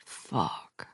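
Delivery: breathy hissed curse under the breath. A narrator's reading. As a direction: hiss it out through the teeth. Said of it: "he hisses out".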